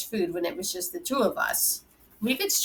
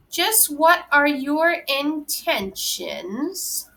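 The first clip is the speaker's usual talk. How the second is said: slow, higher, weighty question; stern interrogation; 8 semitones higher, much slower than usual. A narrator's reading. Grandfather grilling the mother's date, slow and pointed. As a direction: drag each word out like a stern father.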